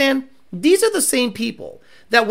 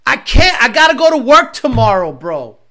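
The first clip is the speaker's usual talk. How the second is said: mocking dumb guy; 2 semitones higher, 6 dB louder than usual. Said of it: "Justin Gully be like".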